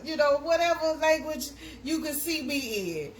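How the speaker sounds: ordinary talk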